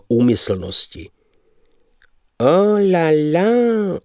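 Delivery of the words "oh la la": high, slow, affected dandy. Acted. The dandy excuses his bad lead with a high, slow oh la la. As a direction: sing out a foppish oh la la. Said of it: "omlouval chybné vynesení".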